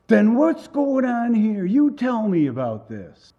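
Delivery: loud, high, drawn-out outburst; upset demand. Retold or imitated. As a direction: demand answers, high and forceful. Upset man demands an explanation.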